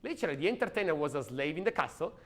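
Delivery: ordinary delivery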